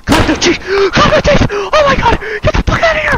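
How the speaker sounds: very high, loud shrieks with gasps between; panicked screaming. Real, not acted. Horror player begging to get out, panicked shrieking. Jump scare in horror game.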